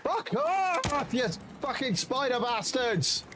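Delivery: high furious yell; angry yell. Real, not acted. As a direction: yell it at the spiders. Yelling at enemy spiders.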